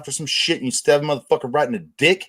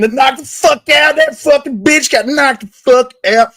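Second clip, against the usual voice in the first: gloating shout; 12 semitones higher, 6 dB louder than usual. Real, not acted. Gloating over a knocked-out rival.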